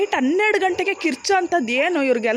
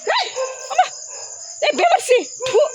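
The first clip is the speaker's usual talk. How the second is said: angry shout; 12 semitones higher than usual. Real, not acted. Fed-up man cursing at barking strays, angry shout. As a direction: spit the insult at them.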